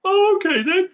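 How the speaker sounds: meek monster about to cry, high and loud; deflated monster. Retold or imitated. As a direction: monster gives in, high and crumbling.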